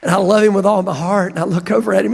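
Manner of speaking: normal talking voice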